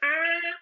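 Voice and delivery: high shrieking cackle of a gleeful poltergeist; squealing mocking cackle. A narrator's reading. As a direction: cackle way up high like a mischievous spirit. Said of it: "Peeves cackled".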